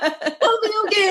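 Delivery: high, giggly insistence; laughing reassurance. Retold or imitated. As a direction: wave them off through laughter. Reassuring paramedics while laughing.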